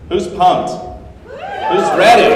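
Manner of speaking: loud, high call to pump up the room; rally the audience with a big shout; hyping the crowd. Real, not acted.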